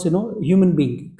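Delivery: ordinary talk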